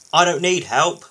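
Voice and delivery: loud, busy brush-off; mildly booming bulldozer voice. A narrator's reading. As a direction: boom it out while busy. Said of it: "boomed Byron busily".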